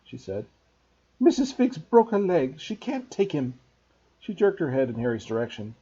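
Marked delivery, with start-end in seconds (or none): 1.2-3.6 angry and worried. A narrator's reading. Aunt breaks bad news to her husband, angry and worried. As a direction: snap out bad news, rattled. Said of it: "looking both angry and worried".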